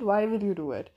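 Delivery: ordinary talk, nothing marked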